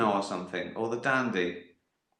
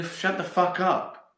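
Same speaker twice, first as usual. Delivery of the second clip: higher, drawn-out dismissive put-down; mock telling-off; 7 semitones higher, slower than usual. Comedian answering an imagined heckler, mock telling-off. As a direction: slowly shut them down, pitched up.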